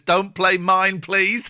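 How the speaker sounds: slow, loud mock plea; mock pleading. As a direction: beg it out, hammed up. Reading viewer's plea in mock voice.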